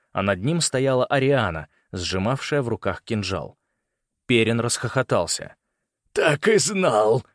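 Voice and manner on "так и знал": slow, raised rasp; strained, hoarse triumph; hoarse croak. A narrator's reading. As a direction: croak it out, drawn out. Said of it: "прохрипел он".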